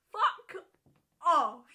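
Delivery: yelled high and loud in disbelief; loud shout. Real, not acted. Reacting to the mother's demand, a loud shout. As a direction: blurt it out at full volume.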